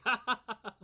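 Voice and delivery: loud, high mock laughter; acted laugh. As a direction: burst into a put-on ha-ha. Game master acts out laughing.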